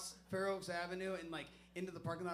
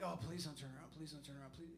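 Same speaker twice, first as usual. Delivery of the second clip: pleading whisper; 4 semitones lower, 7 dB quieter, faster than usual. Retold or imitated. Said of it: "I'm like".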